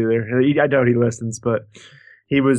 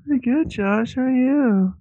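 very high, sugary pretend voice; baby-talking friend; 12 semitones higher than usual. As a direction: answer the podcast greeting in lovey baby talk. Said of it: "his voice went to this weirdest".

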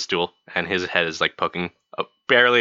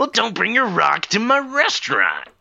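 snippy; 12 semitones higher than usual. Acted. Quarrel with bartender.

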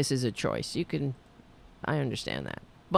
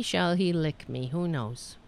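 usual speaking voice

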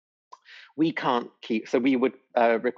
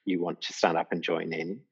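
usual speaking voice

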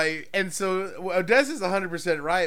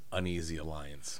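low hushed; 11 semitones lower, 12 dB quieter than usual. Real, not acted. Low dramatic title.

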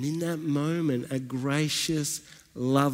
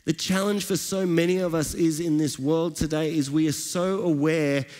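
rising, fervent sermon delivery; preaching; 5 semitones higher than usual. Acted. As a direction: build the praise like a pastor lifting the room. Preaches the passage.

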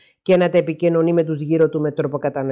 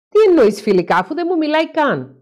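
much higher, louder incredulous outburst; a husband's high-pitched, louder exasperation; exasperated disbelief; 10 semitones higher, 4 dB louder than usual. Acted. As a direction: burst out, as if the question is absurd. Stelios exasperated by the question.

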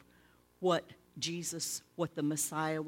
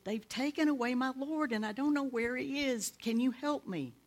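Mary pleading; 9 semitones higher than usual. Acted. Mary pleads, voiced high.

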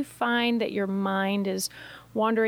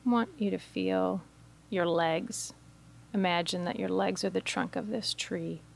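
guided meditation; 4 dB quieter than usual. Acted. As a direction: guide listeners gently into stillness, phrase by phrase. Leads a guided imagery meditation.

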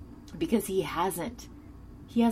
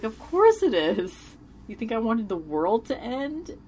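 higher, louder retold exclamation; incredulous retort; 5 semitones higher, 5 dB louder than usual. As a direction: imitate a taken-aback, emphatic comeback. Retold Buffy's indignant reply.

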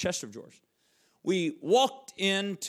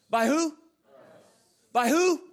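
higher and louder each time; rising call; 8 semitones higher, 4 dB louder than usual. Acted. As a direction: call it out to the room. Preacher calls to congregation.

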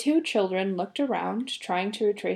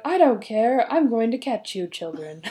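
a touch of a roaring monster; lightly voiced angry monster; bunyip roar; 2 semitones higher, 6 dB louder than usual. A narrator's reading. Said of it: "The Bunyip roared".

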